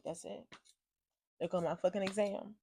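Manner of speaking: very soft, resigned murmur; quiet defeat. Retold or imitated. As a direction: say it under your breath, all hope gone. Gives up on the exam.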